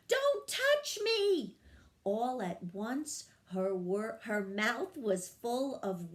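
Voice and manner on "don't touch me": very high, loud, slow shriek; tiny fairy screaming for her life; panicked scream. A narrator's reading. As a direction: shriek it with all her fear. Screaming at squirrel.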